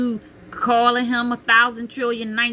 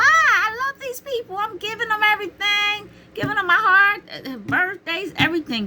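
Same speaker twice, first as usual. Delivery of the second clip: much higher, pleading and wounded; high hurt disbelief; 13 semitones higher than usual. Retold or imitated. Retells her hurt confusion.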